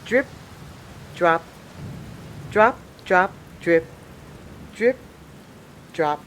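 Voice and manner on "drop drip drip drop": plain reading voice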